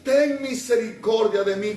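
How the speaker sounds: beggar's plea by the road; blind man crying out. Acted. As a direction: call out to Jesus for mercy. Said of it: "Y comenzó a decir".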